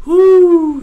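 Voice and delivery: a loud, very high whoop; excited whoo. Retold or imitated. Retelling meeting his idol, an excited whoop. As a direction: let out a starstruck whoop.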